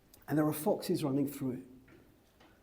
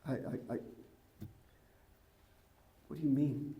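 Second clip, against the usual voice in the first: normal talking voice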